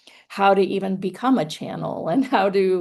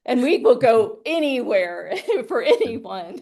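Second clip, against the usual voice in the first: laughing; 6 semitones higher, 4 dB louder than usual. Real, not acted. Laughing about her talk show.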